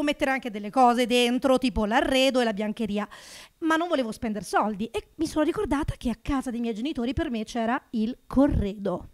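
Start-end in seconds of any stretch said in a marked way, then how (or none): none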